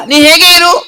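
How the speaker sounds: high puppet voice; puppet reciting. Acted. Puppet recites a missing-person advertisement, high puppet voice.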